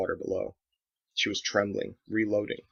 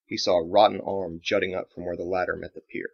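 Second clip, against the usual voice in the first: usual speaking voice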